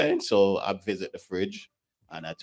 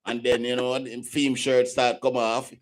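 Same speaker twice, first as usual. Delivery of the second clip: animated Jamaican bad man patois, higher; Jamaican accent; 8 semitones higher, 5 dB louder than usual. Guest retelling a shirts-off face-off, Jamaican accent. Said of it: "I put on my Jamaican accent now".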